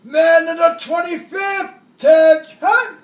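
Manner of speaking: officer barking attention over a loudspeaker; loudspeaker yell. Retold or imitated. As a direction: bellow it through a loudspeaker. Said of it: "loudspeaker yelled".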